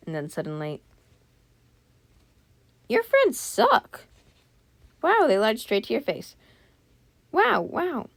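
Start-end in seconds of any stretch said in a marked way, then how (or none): 2.9-8.1 very high, squeaky indignation; high-pitched mock outrage; indignant squeal. Real, not acted. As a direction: squeal your disapproval of the friends. Indignant reaction to the lying friends.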